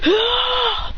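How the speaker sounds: high short yell; battle cry. Acted. Kicking Hit, high battle cry. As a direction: bark the kick yell. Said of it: "And says".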